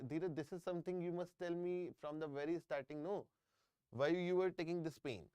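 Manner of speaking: usual speaking voice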